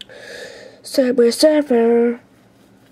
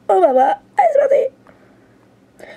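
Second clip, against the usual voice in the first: excited cry; 9 semitones higher, much slower than usual. Real, not acted. Kid starts Flappy Bird run.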